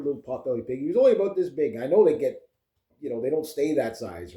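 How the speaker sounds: ordinary talk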